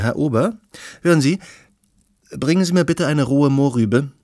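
loud, high call across the room; calling the waiter. Acted. Summoning the waiter for a carrot, a loud call. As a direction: raise your voice to summon him.

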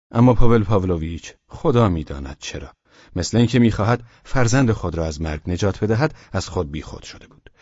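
plain reading voice

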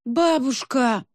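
slow drawn-out moan. A narrator's reading. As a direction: groan the word in despair. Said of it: "простонала Соня".

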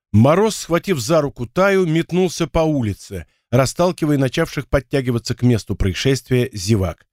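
usual speaking voice

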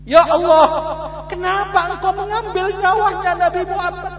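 high, loud, wailing prayer to Allah; old mother's lament. Acted. Old mother prays aloud to Allah, high and wailing. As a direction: cry it out like a grieving mother begging God.

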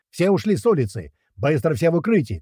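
high-pitched commands to take cover; urgent shouted order. A narrator's reading. Fighter ordering men into cover, urgent shout. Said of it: "кричит Колючий".

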